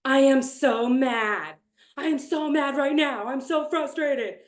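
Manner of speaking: hammed-up "so mad" griping; mock frustration. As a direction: exaggerate an exasperated complaint. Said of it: "I would say things like".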